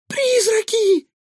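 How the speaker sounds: very high, drawn-out frightened shriek; squeal. A narrator's reading. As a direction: squeal it loudly in panic. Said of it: "взвизгнула Илона". (испуганный визг)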